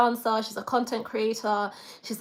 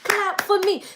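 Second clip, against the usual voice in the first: mock proud; 9 semitones higher, 6 dB louder than usual. Real, not acted. Celebrating a rare success.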